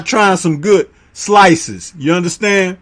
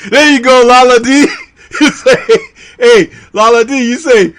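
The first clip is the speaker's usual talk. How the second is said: usual speaking voice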